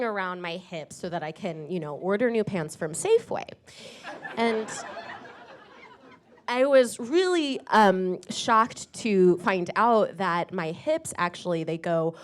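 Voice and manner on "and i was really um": ordinary delivery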